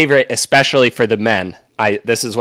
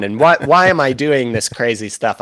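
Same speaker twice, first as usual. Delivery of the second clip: normal talking voice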